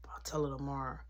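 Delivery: hushed, unvoiced promise; whispered stalling. He whispers to Mary he'll tell Tracy tomorrow, hushed. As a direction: breathe it out to buy time.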